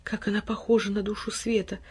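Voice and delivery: soft, awed murmur; hushed and enraptured; rapt whisper. A narrator's reading. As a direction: marvel under your breath. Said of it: "восторженно прошептал Тильтиль".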